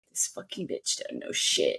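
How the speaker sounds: mock muttered aside, low and soft; imagined scornful onlookers. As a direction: drop into an under-the-breath judgment. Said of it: "They could be like".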